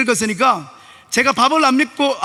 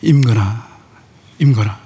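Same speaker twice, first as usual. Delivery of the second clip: voice of God; 12 semitones lower than usual. Retold or imitated. God calling the pastor's name, deep and solemn. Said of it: "하나님께서 저에게 말하는 거예요".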